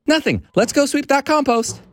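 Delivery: put-on high-pitched boy, too eager; nervous cover-up. Boy hiding the dryer noise from dad, nervously eager. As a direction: brush it off in a high kid's voice.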